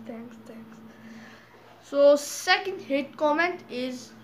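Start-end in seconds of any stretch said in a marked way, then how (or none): 0.1-0.8 whispered thanks, dry and mocking; sarcastic whisper. Real, not acted.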